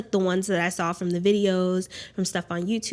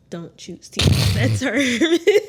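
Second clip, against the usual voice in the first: laughing; 6 semitones higher, 8 dB louder, much slower than usual. Real, not acted. Teasing husband's term.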